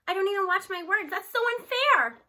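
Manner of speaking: high, loud, slowing on so unfair; outraged protest. Acted. Teacher protesting the unfair rule to her boss, outraged. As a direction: let the outrage spill over.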